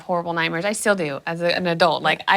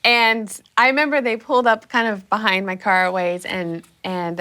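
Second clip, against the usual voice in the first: normal talking voice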